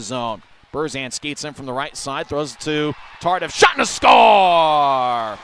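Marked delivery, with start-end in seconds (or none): 3.5-5.4 goal call shout. Real, not acted. Goal call in hockey broadcast.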